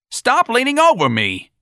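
high, loud scream. Acted. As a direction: scream at him to back off. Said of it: "the sergeant screamed".

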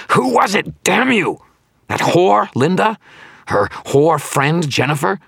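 very high, loud furious demand; screaming. A narrator's reading. Villain guessing who betrayed him, screaming. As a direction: keep screaming the accusations. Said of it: "Victor screamed at me".

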